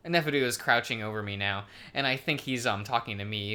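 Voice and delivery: ordinary talk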